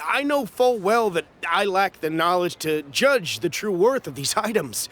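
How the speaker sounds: ordinary talk, nothing marked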